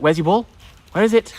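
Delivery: high, bright call to the dog. Real, not acted.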